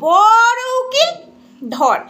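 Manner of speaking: sarcastic echo, drawn out; mocking echo. Real, not acted. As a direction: drag out বড় উকিল to sneer at it. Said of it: "আর তুই বড় উকিল ধরেছিস না".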